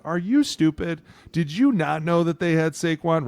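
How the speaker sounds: are you kidding me; incredulous. Retold or imitated. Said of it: "everyone's gonna look at you and be like".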